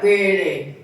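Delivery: short, firm comeback, falling in tone; stern security woman. Retold or imitated. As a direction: imitate a stern guard standing her ground. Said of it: "this very stern security woman".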